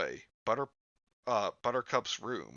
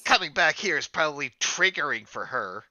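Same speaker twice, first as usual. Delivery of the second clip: higher, louder put-on character voice; pitched-up script-reading voice; Buttercup; 5 semitones higher, 6 dB louder than usual. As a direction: read it in a raised, forceful cartoon voice.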